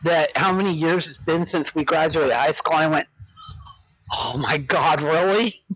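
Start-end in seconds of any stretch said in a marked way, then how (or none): none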